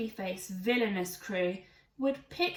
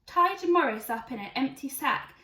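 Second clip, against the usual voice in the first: plain reading voice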